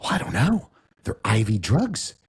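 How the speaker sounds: mom's voice. Retold or imitated. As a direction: drag it out like a suspicious mother. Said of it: "She's like".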